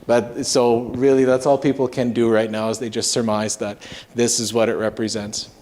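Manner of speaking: usual speaking voice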